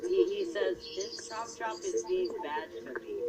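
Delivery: lightly voiced complaining child; a hint of a whiny little kid tattling; imitated small child. Retelling the three-year-old's complaint about the toy, lightly imitated child. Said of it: "walks back in here and goes".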